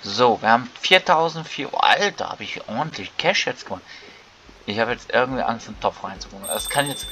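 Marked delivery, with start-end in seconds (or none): none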